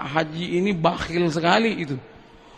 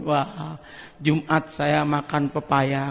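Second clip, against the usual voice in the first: lower, soft, slow delight; quiet pleased musing; 7 semitones lower, 6 dB quieter, slower than usual. Retold or imitated. Old man looking forward to his ripe papayas, soft and slow. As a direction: savour the thought of Friday's papaya.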